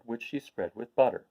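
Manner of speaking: plain reading voice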